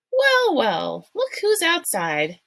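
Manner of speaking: light, breezy mother's voice, lifted high. A narrator's reading. Mother greeting the neighbors across the street, breezy and high. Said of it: "her mother said breezily".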